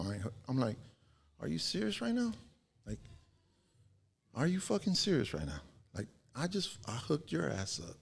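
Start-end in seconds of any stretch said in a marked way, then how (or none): 1.4-8.0 high-pitched, indignant disbelief; retold outraged comeback, voice jumping up high; incredulous protest. Guy protesting to the friend he once hooked up, incredulous. Arguing back after her refusal.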